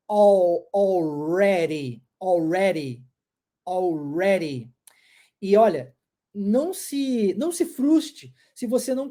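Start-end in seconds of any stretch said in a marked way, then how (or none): 0.7-4.7 "already" with the D turned into a quick tapped R, slowly; D as flapped R. Shown as a demonstration. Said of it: "Eu vou transformar em R". ("already" com o D virando um R rápido)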